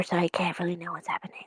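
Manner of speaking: fast, breathy whisper. Real, not acted. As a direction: whisper it under your breath. Whispered aside.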